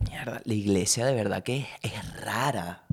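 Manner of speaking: hushed, breathy whisper of disbelief; a whispered aside about the church; awed whisper. Retold or imitated. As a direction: whisper it like a secret shock. Quoting own thought in awe.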